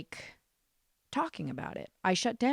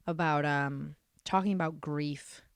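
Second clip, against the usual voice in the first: ordinary delivery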